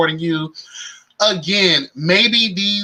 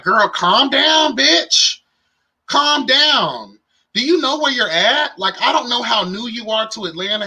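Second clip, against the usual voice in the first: sassy scolding; 5 semitones higher than usual. Retold or imitated. Voicing Delicious scolding in club.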